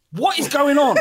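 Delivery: high, loud laughing disbelief; outraged disbelief. Real, not acted. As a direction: cry out at the absurdity. Outraged at pundit's award.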